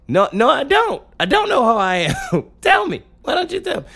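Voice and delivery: high-pitched mock-exasperated pleading; exasperated protest. Comic pleading with people who assume he knows, high exasperated protest. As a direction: protest with the voice climbing way up.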